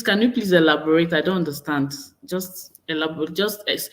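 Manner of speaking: ordinary delivery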